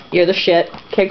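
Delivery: ordinary talk, nothing marked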